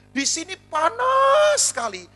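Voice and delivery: PANAS stretched long, high and loud; slow, hammed-up stress on "panas"; exaggerated heat complaint. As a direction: wail out "panas" in one long held high note. Husband's email voiced, complaining of heat.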